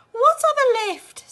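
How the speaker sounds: high loud slow shriek; panicked scream. A narrator's reading. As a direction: scream it in sudden terror. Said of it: "screamed Missus T V".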